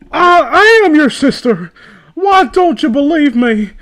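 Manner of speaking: high, loud mock plea; overacted desperate plea; pleading quote. Reading fanfic aloud, she hams up a desperate plea. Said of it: "I asked".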